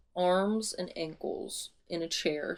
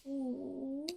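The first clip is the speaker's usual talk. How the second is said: quiet, high-pitched coo of intrigue; soft high ooh; 6 semitones higher, 10 dB quieter than usual. Real, not acted. Reader reacts to the wish revelation with a soft high ooh. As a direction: let out a small high "ooh".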